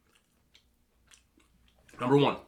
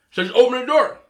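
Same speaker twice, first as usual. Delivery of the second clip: mock angry ex; 9 semitones higher, 7 dB louder than usual. Ex demanding at door.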